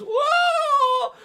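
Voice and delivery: long, high, loud "whoa"; toppling yell. Retold or imitated. Big kid knocked over at first base, falling yell. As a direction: cry out as you topple over. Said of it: "Boom, the kid goes".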